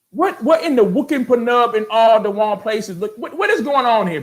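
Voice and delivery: high, loud, disbelieving rant; incredulous rant. Real, not acted. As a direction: go up high and let the disbelief rip. Ranting at a commenter.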